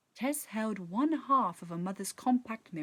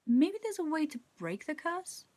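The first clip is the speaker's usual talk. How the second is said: sudden idea; 8 semitones higher than usual. A narrator's reading. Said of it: "Tess asked suddenly".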